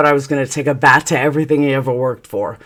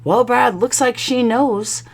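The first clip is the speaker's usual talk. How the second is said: higher, lightly mimicked bystander; friend's knowing remark; 9 semitones higher than usual. Best friend telling husband he's caught, lightly mimicked. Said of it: "this guy Joe, had said".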